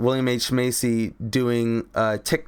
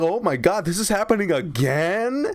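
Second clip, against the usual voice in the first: higher mock exasperation; hammed-up disbelief; exasperated Zac; 6 semitones higher than usual. Zac Efron groaning that it is happening again, higher.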